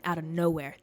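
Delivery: ordinary talk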